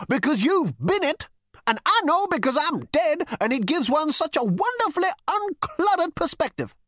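put-on high, scornful old man; ghostly great-grandfather scolding; crotchety old ghost. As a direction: sneer down at the useless great-grandson. Ghost lectures.

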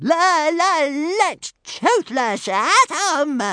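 very loud, high stuttering shriek. A narrator's reading. Toothless unable to bear waiting one moment longer, shrieking. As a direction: explode in a long squawking dragon shriek. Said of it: "shrieked Toothless".

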